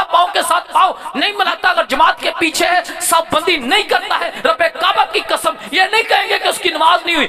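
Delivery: high, loud, driving sermon delivery; impassioned high-pitched preacher; full-force preaching. Real, not acted.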